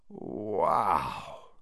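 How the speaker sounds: soft, low breathy wonder; awed sigh. Acted. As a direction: breathe it out in awe. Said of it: "Ron sighed".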